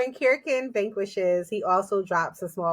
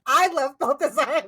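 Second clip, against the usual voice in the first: I love Balthazar, second time louder and rising; louder mock swoon; 4 semitones higher, 5 dB louder than usual. Fan swooning over Balthazar, louder. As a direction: gush it like a fan. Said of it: "I was like".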